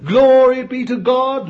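high, loud cry of glory; shouted praise. Real, not acted. Preacher at his peak, crying glory aloud. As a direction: ring it out to the rafters.

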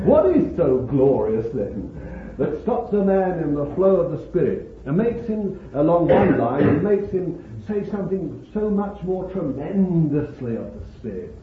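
usual speaking voice

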